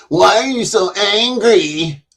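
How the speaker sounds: high, slow mocking whine; mocking critics. Streamer mocking critics with a high, slow whine. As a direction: mimic them, high and drawn out. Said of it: "they're like".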